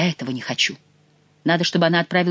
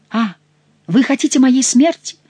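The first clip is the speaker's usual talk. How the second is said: горестно; 8 semitones higher, 6 dB louder than usual. A narrator's reading. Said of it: "горестно сказала Екатерина".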